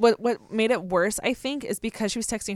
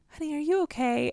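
gentle mom; 6 semitones higher, 5 dB quieter than usual. Retold or imitated. Mom comforting softly.

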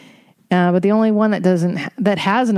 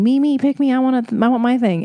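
mock-eager, higher, pleading "me, me, pick me"; whiny crowd; 6 semitones higher than usual. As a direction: imitate a needy crowd begging to be chosen. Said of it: "a sea of people going".

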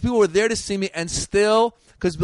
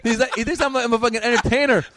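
normal talking voice

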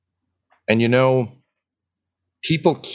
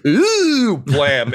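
mock surprised gasp and blam; 9 semitones higher, 5 dB louder than usual.

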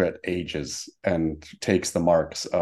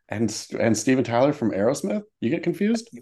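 teasing disbelief; 5 semitones higher than usual. Real, not acted.

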